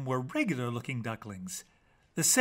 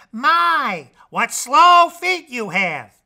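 taunting; 8 semitones higher, 13 dB louder, much slower than usual. Acted. Red taunts the wolf.

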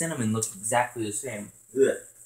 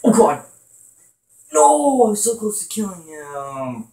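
frustrated cry; 8 semitones higher, 8 dB louder than usual. Real, not acted. Near miss in game.

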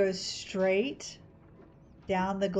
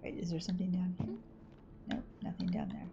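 whispering; 9 dB quieter than usual. Real, not acted. Peering around in the game.

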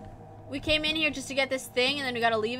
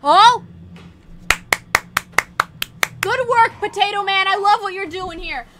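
nervous mock praise; 5 semitones higher, 8 dB louder than usual. Real, not acted. Mocking praise to monster.

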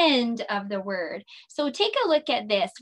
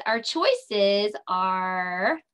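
slow, stretched build-up; drawn-out suspense; 3 semitones lower, much slower than usual. Shown as a demonstration. Teacher to kids, slow suspenseful lead-in. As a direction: draw it out for suspense. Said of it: "here it comes".

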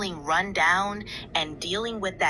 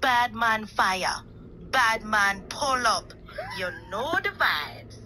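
put-on Jamaican accent. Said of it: "your Jamaican accent".

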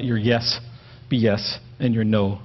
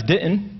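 didn't said higher with a rise; didn't pitched up and rising; stress on didn't; 5 semitones higher than usual. Shown as a demonstration. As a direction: lift the word didn't up high. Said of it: "if you emphasize".

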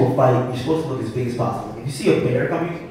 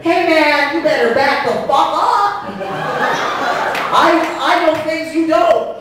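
very high, loud failed threat; worst tough-guy voice; 6 dB louder, slower than usual. Acted. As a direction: try to intimidate in a squeaky yell. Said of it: "the worst voice".

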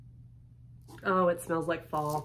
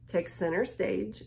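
ordinary talk, nothing marked

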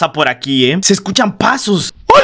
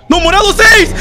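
loud, higher protest at the screen; frustrated yell; 11 semitones higher, 4 dB louder than usual. Real, not acted. Player arguing with the game's call, loud frustrated yell. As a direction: shout back at the game in dismay.

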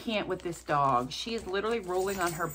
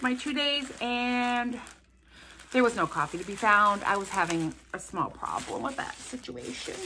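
normal talking voice

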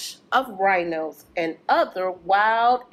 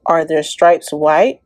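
louder, lively rhyming read-aloud; reading to children; 9 dB louder than usual. Acted. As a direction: bounce through the rhymes for the kids. Reads rhyming picture book aloud.

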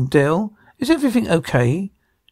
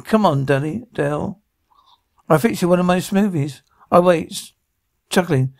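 usual speaking voice

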